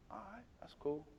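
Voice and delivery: breathy murmured agreement; whispered reluctant okay. Retold or imitated. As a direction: mumble it, unconvinced. Son unconvinced, murmuring.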